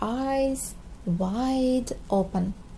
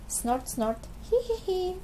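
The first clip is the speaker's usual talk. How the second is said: snorting giggle; 8 semitones higher, 4 dB quieter than usual. A narrator's reading. Family laughing noises.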